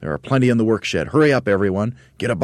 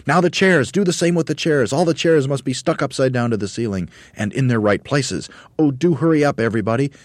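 high, loud urgent directing; excited orders; 9 semitones higher, 5 dB louder than usual. A narrator's reading. Monkey leader directing chair work, excited. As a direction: hurry everyone in a raised voice. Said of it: "I'm so excited".

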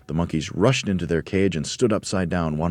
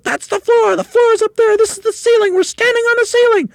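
very high, very loud panicked screech; screaming; 11 dB louder than usual. A narrator's reading. Mrs Twit believes they stand on the ceiling, screaming. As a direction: shriek each realisation in horror. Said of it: "screamed Missus Twit".

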